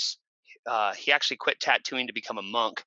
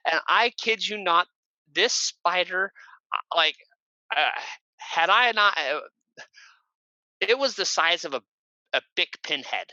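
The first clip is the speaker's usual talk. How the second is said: ordinary talk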